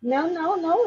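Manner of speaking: ordinary talk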